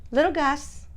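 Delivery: high sing-song pet voice, calling him; high cooing pet name. Real, not acted. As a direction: coo his name up high like to a puppy. Coaxing her dog by name.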